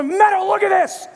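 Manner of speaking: urgent, come see this; excited call. Retold or imitated. Calling wife over excitedly.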